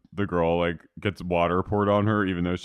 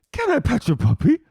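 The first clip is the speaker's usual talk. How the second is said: put-on much higher, sweet little-girl voice; high cutesy voice; 5 dB louder than usual. [name] to a little girl, high sweet voice. Acting out girl asking about dog.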